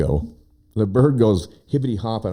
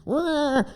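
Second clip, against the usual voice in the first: imitated cat yowl; 3 dB quieter than usual. Cat yowling at the bird.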